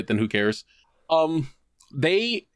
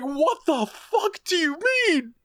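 much higher, slow, disbelieving cry; incredulous; slower than usual. Retold or imitated. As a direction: let the disbelief crack the pitch upward. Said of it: "And we're like".

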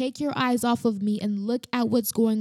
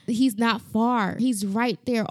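ordinary talk

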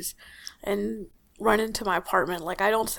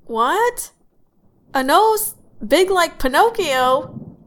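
shocked surprise; 8 semitones higher, 8 dB louder, slower than usual. Acted. Host shocked by caller.

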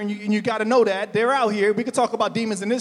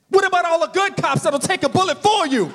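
preaching at full force; 5 semitones higher than usual. Acted. Pastor rallies the church, preaching at full force. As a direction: drive the point home to the pews.

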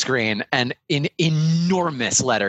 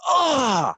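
sharp intake, then a high "ah"; gasp, then let out a high, drawn cry; blissful gasp; 12 semitones higher than usual. Acted. Computer as the server is plugged in, gasping in delight. Computer gets its files back.

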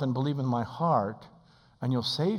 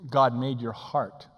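ordinary talk

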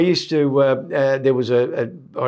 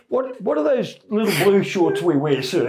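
higher eager sailor voice; the silly voice; 6 semitones higher than usual. Retold or imitated. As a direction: overeager goofy sailor. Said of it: "do the voice on him".

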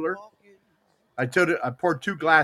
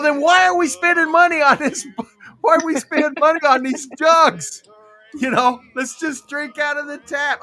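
higher and louder, laughing exasperation; mock outrage at paying for water; exasperated; 9 semitones higher, 5 dB louder than usual. Grandson retelling his outburst to grandma, loud laughing exasperation.

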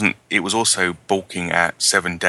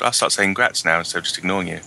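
ordinary talk, nothing marked

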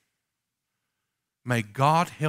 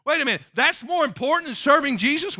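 incredulous; 12 semitones higher, 5 dB louder than usual. Real, not acted. Preacher reacts to excuses.

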